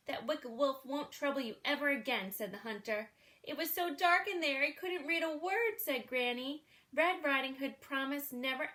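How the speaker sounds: put-on lively read-aloud for children; storybook reading. A narrator's reading. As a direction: read it like a bedtime picture book. Reads a picture book aloud.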